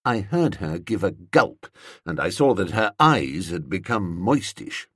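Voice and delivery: plain reading voice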